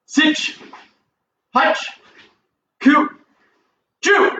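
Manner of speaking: sharp high count calls; counting cadence. Shown as a demonstration. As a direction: bark out each count for the class. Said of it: "I count, then you punch".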